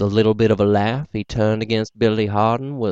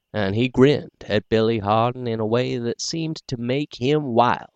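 ordinary delivery, nothing marked

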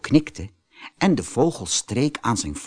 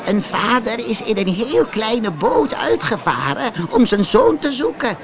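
put-on a higher, worried old woman's voice; a villager explaining the lost father; 10 semitones higher than usual. A narrator's reading. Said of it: "zei een oude vrouw".

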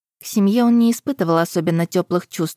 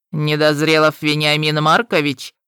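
voice from the underworld; 2 semitones lower than usual. Acted. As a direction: speak like something from below. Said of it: "знакомый голос из преисподней". (голос из преисподней)

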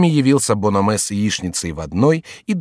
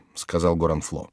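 ordinary delivery, nothing marked

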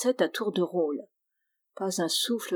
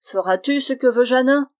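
loud, higher-pitched challenge; insistent demand; 5 semitones higher, 6 dB louder than usual. A narrator's reading. Goblin to the friar it rides, loudly demanding. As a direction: press the victim for an answer.

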